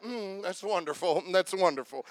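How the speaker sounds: soft, lukewarm praise; bored churchgoer. Retold or imitated. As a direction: say it like it barely matters to you. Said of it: "you're like".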